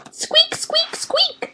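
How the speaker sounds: very high, soft squeaks; hamster squeaks. A narrator's reading. Humphrey answering Aldo about music, high hamster squeaks. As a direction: squeak like a tiny hamster trying to talk.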